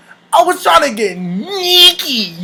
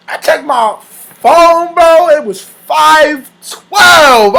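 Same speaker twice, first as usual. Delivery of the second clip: slow, loud, stretched-out incredulity; mock outraged disbelief; 4 semitones higher, 4 dB louder, much slower than usual. Telling bro how short it lasted, aghast. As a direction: drag out each key word, louder and higher. Outraged at only two minutes passed.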